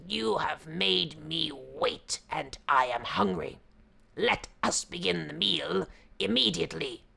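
a hungry, impatient tiger. Acted. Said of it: "he leaped to his feet and said".

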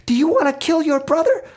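high-pitched, incredulous scolding; outraged mother. Acted. Mother confronts son holding a board, high outraged demand.

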